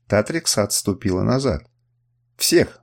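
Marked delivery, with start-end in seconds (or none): 2.4-2.8 higher, emphatic one-word demand; mild exclamation, punched; exclaimed. A narrator's reading. Warrior demanding all captives freed, exclaimed. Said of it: "воскликнул я".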